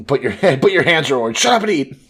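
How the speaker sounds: loud, high mock yell; mock scolding. Retold bickering over tangerines at the table, loud mock scolding.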